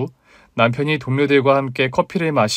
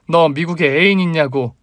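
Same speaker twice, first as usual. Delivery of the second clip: higher insistent demand, falling on 있냐고; shouted demand; 4 semitones higher, 4 dB louder than usual. A narrator's reading. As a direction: demand an answer, voice raised. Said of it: "똑바로 쳐다보며 다시 외쳤습니다".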